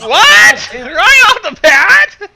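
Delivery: high, loud disbelieving yell; shocked laughing yell at the blurb; laughing shout. Real, not acted. Incredulous shouting at fic blurb.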